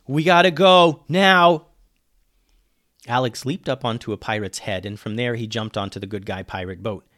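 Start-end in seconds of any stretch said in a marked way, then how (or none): 0.1-1.7 loud, raised urgent shout; yelling. A narrator's reading. Ben urges Alex to flee the pirates, yelling. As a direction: shout that there is no time left. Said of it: "yelled Ben".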